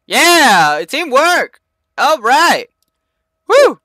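loud, high victory whoops; excited cheer. Real, not acted. Gamer cheering a teammate's kill, loud excited whoop. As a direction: celebrate like you just won. Celebrating teamwork win.

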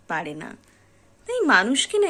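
usual speaking voice

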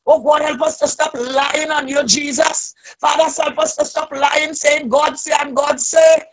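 ordinary delivery

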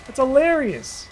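ordinary talk

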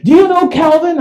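high, loud cop question; urgent questioning. Retold or imitated. Cop questioning the homeowner at the cruiser, urgent. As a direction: press hard, high and loud.

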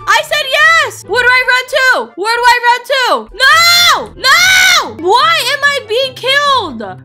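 high, loud, frantic cries; panicked yelling. Real, not acted. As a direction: wail in panic while being chased. Panics while chased in game.

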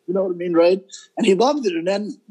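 ordinary delivery

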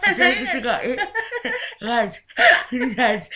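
loud high laughing plea. Real, not acted. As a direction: squeal it through laughter. Laughing fit while eating.

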